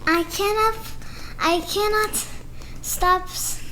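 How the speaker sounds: lively picture-book read-aloud with character voices; reading to children. Acted. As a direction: read it like story time for little kids.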